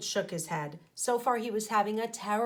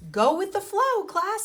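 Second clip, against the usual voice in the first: high, loud cheerful call; happily; 11 semitones higher, 7 dB louder than usual. A narrator's reading. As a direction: sing it out to the class. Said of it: "said Miss Frizzle happily".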